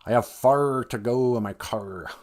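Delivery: slow Midwestern accent with heavy Rs; Midwestern hard R. Shown as a demonstration. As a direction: exaggerate every Midwestern R, unhurried. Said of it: "we kinda pull out our chins".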